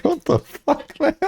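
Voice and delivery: high, laughing bewilderment; amused disbelief. Retold or imitated. Retelling shock at the tram smoker, high amused disbelief. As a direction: squeal it through laughter. Retold baffled reaction, laughing.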